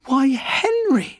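high, slow astonished cry; exclaiming. A narrator's reading. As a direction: marvel at it, very high. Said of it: "she exclaimed".

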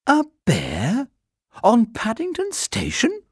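high, startled disbelief; amazed. A narrator's reading. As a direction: gasp it out in disbelief, pitched high. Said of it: "looked at her husband in amazement".

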